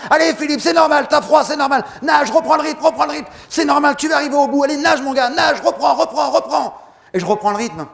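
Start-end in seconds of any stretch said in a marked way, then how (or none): 0.1-6.8 high, loud urgent yelling; team yelling. Retold or imitated. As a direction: bark the swimmer back into rhythm. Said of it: "mon équipe qui me gueule dessus". (l'équipe crie à Philippe de reprendre le rythme, cri aigu)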